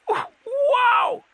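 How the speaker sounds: very high amazed troll; breathed awe. A narrator's reading. Troll learning it has met Cohen, awestruck. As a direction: gasp it out high in wonder. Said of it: "it breathed".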